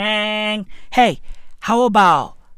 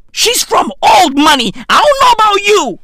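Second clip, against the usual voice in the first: loud and higher, pushing hard; indignant shout; 8 semitones higher, 5 dB louder than usual. Real, not acted. Ranting to viewers about her old money, loud.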